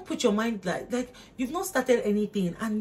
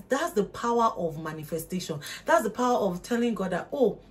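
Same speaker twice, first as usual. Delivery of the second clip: normal talking voice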